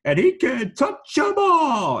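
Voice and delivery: high, slow, booming announcer call; home run call. Shown as a demonstration. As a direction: belt it like the ball just left the park. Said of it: "my home run call is".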